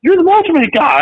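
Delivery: excited stranger. Retold or imitated. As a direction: mimic a fan who suddenly recognizes him. Stranger recognizing him, excited.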